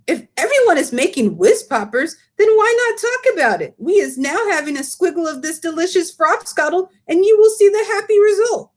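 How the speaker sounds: high, eager giant voice with muddled grammar; the BFG lecturing, indignant; big friendly giant. Acted. Giant delights in drink.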